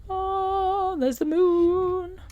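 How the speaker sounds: high, slow, wonder-struck; awed. Real, not acted. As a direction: marvel at the sight. Sees the moon.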